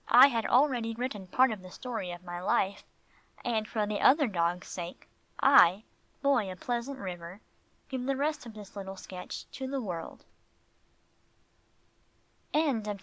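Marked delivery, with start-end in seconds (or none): none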